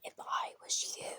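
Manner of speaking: insistent whisper, very soft. Retold or imitated. Stranger repeating his tip to the narrator, whispering. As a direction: repeat the secret in a measured whisper.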